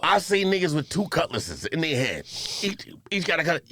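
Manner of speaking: normal talking voice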